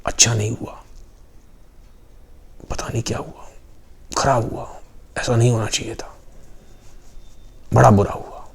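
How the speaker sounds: soft, nearly whispered gossip; hushed murmur. Acted. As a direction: mutter so the grieving husband won't hear. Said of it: "अब धीरे आवाज़ आ रही है". (दबी आवाज़ में फुसफुसाना)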